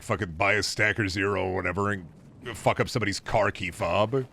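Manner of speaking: ordinary talk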